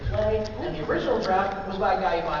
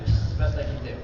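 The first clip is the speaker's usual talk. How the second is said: ordinary delivery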